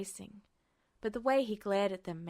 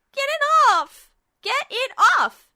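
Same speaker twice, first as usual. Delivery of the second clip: much higher, much louder screaming; panicked holler; 17 dB louder than usual. A narrator's reading. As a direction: yell it at the top of your lungs to get the bird off. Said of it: "she hollered at the top of her lungs".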